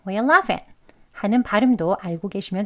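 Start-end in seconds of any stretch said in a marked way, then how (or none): 0.1-0.6 Why are you laughing clipped and quick; fast. Shown as a demonstration. Teacher shows the quick form in conversation. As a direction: say it fast in one breath. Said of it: "정말 빨리 발음할 때에".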